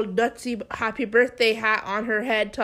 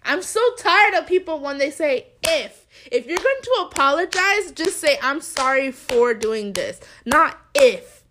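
louder and higher, fed up; telling off the coworker over her apology; heated retort; 4 semitones higher, 7 dB louder than usual. Retold or imitated. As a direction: let the frustration rise. Retelling her angry retort.

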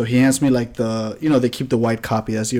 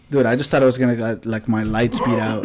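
ordinary talk, nothing marked

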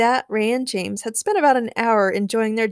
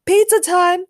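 high, loud battle cry; heroic shout; 11 semitones higher, 9 dB louder than usual. A narrator's reading. Spider-Man swings into the mansion, shouting. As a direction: announce your arrival at full voice. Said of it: "Spider-Man shouted as he swung".

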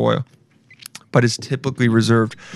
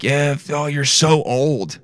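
slow, drawn-out taunt; mock a smug kid slowly; teasing "you're so old", slow and snide; mocking young person; much slower than usual. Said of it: "They're like".

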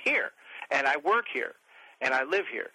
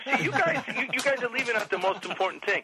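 high-pitched worked-up protest; comic exasperation; exasperated rant; 9 semitones higher than usual. Real, not acted. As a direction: plead your case, voice pushed up high.

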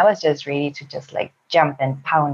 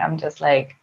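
usual speaking voice

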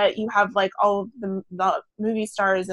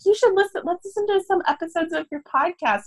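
imitated mom; 8 semitones higher than usual. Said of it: "my mom ... was like".

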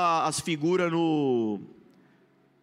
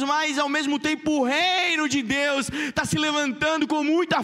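preaching; 5 semitones higher than usual. Acted. Preaching at full force.

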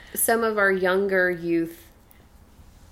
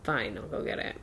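breathy whispered grumble, much lower and softer; grudging kid; 8 semitones lower, 10 dB quieter than usual. Retold or imitated. Son giving in to mom, sulky whispered mumble. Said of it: "And he's like".

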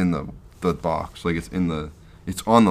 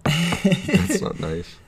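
mock hurt; 12 semitones higher, much slower than usual. Mock hurt after insult.

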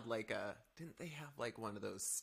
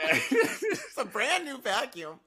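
slow, loud, high outrage; laughing indignation; 13 semitones higher, 12 dB louder, much slower than usual. Real, not acted. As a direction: stretch it out in mock outrage. Still indignant about vacuum.

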